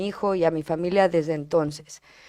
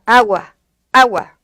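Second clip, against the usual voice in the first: loud, insistent single-word demand; niño pidiendo agua; 3 semitones higher, 9 dB louder, slower than usual. Retold or imitated. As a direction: call it out like a child who learned it. (pedirlo fuerte, como niño)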